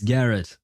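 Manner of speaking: "Garrett" as GARR-ut, weight up front; firm, falling "Garrett"; anglicised pronunciation. Shown as a demonstration. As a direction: insist on "Garrett" with a weak second syllable. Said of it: "I refuse to believe this".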